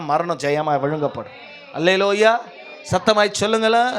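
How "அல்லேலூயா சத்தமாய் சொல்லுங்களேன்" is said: ordinary delivery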